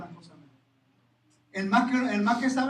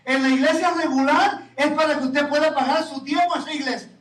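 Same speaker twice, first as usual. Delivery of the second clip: fervent preaching; 4 semitones higher, 5 dB louder than usual. Acted. Preaching at full force.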